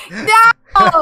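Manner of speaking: high shouted no; loud protest. Real, not acted. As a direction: cry out in protest. Protesting being teased.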